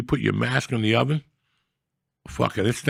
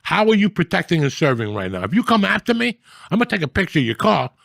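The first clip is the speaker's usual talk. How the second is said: high, loud indignant rant; retold rant at cops; 7 semitones higher, 4 dB louder than usual. Yelling at the cops blocking Monday traffic, high angry rant. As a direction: tear into the cops, fired up.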